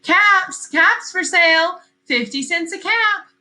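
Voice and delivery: high, loud sing-song calling out wares; peddler's street call. Acted. Peddler calling his caps for sale down the street, loud and high. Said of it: "walked up the street calling".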